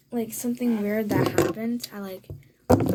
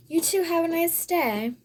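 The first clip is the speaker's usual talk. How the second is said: higher, louder sing-out farewell; imitated polite goodbye; 7 semitones higher, 5 dB louder than usual. Old woman taking leave of girl and dad, imitated goodbye. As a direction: act out a stranger's pointed goodbye, pitched up.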